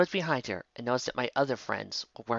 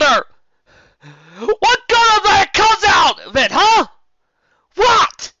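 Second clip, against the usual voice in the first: high, loud sobbing screams; anguished yelling; 14 dB louder than usual. A narrator's reading. Applejack screaming at her dead parents through tears. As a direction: wail your grief at the sky.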